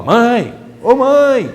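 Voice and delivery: put-on high, loud child's shout; child yelling for mom. A boy interrupting his mother's recording, yelling. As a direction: call out like a kid who won't stop. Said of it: "o menino lá".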